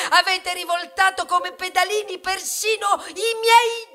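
sfogo urlato, più alto; grido rabbioso. Acted. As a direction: grida l'accusa agli umani. Sfogo rabbioso del grano. (grido furioso e più acuto)

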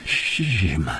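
low, hushed, hesitant husky voice; subdued, halting plea for denial; hoarse. Acted. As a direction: falter quietly, voice gone hoarse. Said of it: "哑着声音说".

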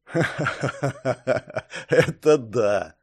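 slow, drawn-out acted laugh running into the words. A narrator's reading. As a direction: laugh heartily, then agree slowly. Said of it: "рассмеялся Жукс". (со смехом, медленно и протяжно)

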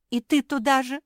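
high, loud hurt cry, acted; mock wailing. A narrator's reading. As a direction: wail it high as if bursting into tears. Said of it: "разрыдалась Куки".

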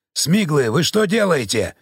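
plain reading voice

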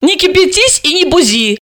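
high-pitched lively character voice; Alisa. Acted.

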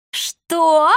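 high, outraged squawk of a question; flaring up. A narrator's reading. Old woman stung by the insult, flaring up. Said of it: "взвилась та".

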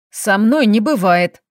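usual speaking voice

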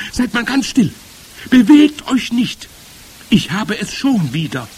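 high, loud witch orders; urgent call. A narrator's reading. Sniffing witch tells all to freeze, calling out. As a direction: command stillness in a high cry. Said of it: "rief die Hexe".

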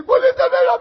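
mother-in-law voice. Retold or imitated. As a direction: squeal it like his mother-in-law. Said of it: "Y mi suegra viene".

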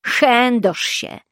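slow, mild narrated yell of a curse; slow, lightly voiced angry yell. A narrator's reading. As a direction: yell it, but keep it understated and slow. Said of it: "wrzasnął Menchit".

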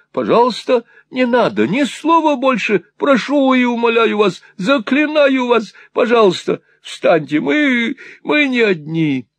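high-pitched, urgent imploring; agitated pleading. A narrator's reading. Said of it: "в сильном волнении восклицал".

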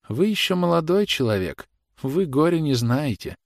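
high, pleading reproach without extra volume; reproachful mother. A narrator's reading. Agitated mother reproaches the officer.

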